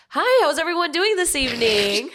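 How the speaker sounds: server voice. Shown as a demonstration. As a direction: greet the diners sweetly. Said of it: "what I would say when I walk up to my tables".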